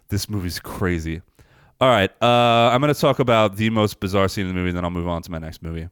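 normal talking voice

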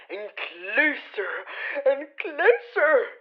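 slow, climbing to a high loud cry; mounting panic. A narrator's reading. Captive watching a huge syringe approach, mounting panic. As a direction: build the dread with each repeat of closer.

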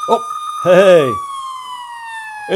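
high wailing siren sound; siren imitation. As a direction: wail like an alarm siren. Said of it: "It's the siren sound".

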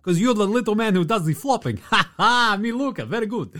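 a high, put-on foreign voice, smug and teasing; mock Luka. Luka taunting Chris Paul about flopping, mock smug high voice. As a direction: ham up a smug foreign star gloating. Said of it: "And Luka's like".

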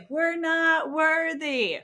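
high, slow shout of worship; shouted catchphrase. Retold or imitated. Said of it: "Wayne's World".

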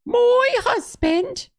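very high, slow, exaggerated British accent; squeaky overdone British woman; mock British accent. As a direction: do a hammed-up posh English lady. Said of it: "So I would go British".

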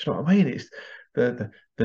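normal talking voice

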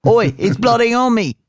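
high-pitched cartoon Aussie; Australian accent. Shown as a demonstration. Showing the hosts a high, hammed-up Australian accent. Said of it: "do you have an Australian accent".

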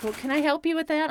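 high, lightly voiced helpful clerk; a hint of an eager store clerk. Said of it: "And they're like".

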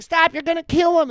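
high yelled plea to stop; imitating a panicked crowd; mock crowd yell. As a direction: yell it to break up the fight. Crowd yelling to stop fight.

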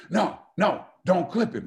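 high, loud warning; coach yelling. Retold or imitated. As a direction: bark the warning like a fired-up coach. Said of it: "this guy just screams at you".

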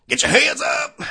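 high, loud shout; shouted quote. Retold or imitated. Host blurting a catchphrase stuck in his head, loud yell. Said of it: "Sorry, I can't get that out of my head".

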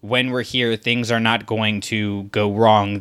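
ordinary talk, nothing marked

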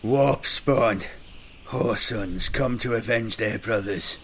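strained, effortful rasp of last words; dying warning. A narrator's reading. Dying sergeant warns the apothecary, strained and halting. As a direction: force out the warning in broken bursts.

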